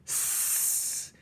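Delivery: long unvoiced rat hiss. A narrator's reading. The rats hiss at the crying Roscaro. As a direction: hiss in disapproval. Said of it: "hissed the other rats".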